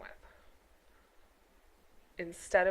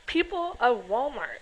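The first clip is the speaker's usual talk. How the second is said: drawn-out, slower repeat of the name; slow disbelief; 4 semitones higher, 3 dB louder, slower than usual. Real, not acted. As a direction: stretch out People of Walmart in disbelief.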